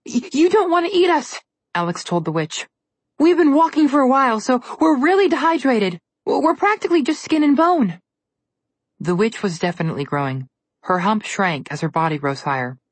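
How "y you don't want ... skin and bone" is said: nervous stammer. Acted. Girl stammering before witch.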